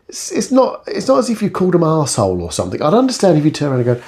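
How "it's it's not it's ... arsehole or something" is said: usual speaking voice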